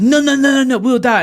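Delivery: high, loud panicked protest; mock panic. Reporter refusing to run under gunfire, mock panic.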